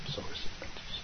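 soft whispered aside; whispering. Shown as a demonstration. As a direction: whisper it under your breath. Repeats line, then whispers it.

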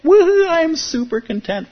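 put-on high, loud burst of childish glee; hammed-up giddy cheer; mock delight. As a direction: squeal it like a kid on Christmas morning. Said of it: "that sort of Christmas morning thing of like".

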